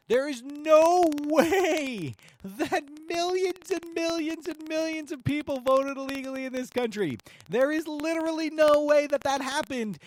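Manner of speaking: high, loud, incredulous outburst; exasperated rant. Real, not acted. Host rebuts claim of millions of illegal votes, exasperated rant. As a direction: build to a high-pitched, outraged yell.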